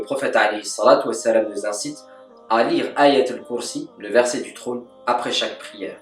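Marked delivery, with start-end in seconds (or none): none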